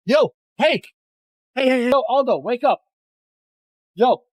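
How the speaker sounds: high, urgent pleas to wake up; worried calling. Real, not acted. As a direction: shake him awake with your voice. Friend found lying on ground.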